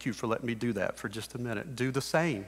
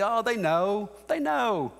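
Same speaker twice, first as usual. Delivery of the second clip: high mock brush-off, jumping up on "they know"; dismissive dad; 6 semitones higher than usual. Preacher mocking a dad's excuse, high and dismissive. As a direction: play the dad who shrugs it off. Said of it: "You can't just say".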